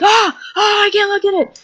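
very high squeal of revulsion; hammed-up squeamish shout; mock horrified cry. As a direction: imitate recoiling from something sickening. Imitating extra's horror at fake vomit.

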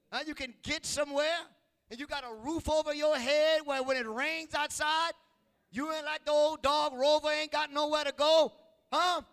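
high, loud rising preacher's call; preaching at full force. Acted. Preacher calling out to children to be thankful, loud and high.

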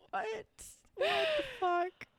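what the fuck squeaked up high in disbelief. Real, not acted. To her cohost after the murder details, a high disbelieving squeak. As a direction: pitch jumps way up in shock. Stunned by the gruesome case.

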